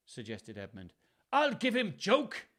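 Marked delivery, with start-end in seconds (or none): 1.3-2.5 high, loud, slow Welsh outrage; snarling. A narrator's reading. Furious father scorns the joke excuse, Welsh snarl. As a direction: snarl it in hammed-up stage Welsh. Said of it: "snarled Gloucester".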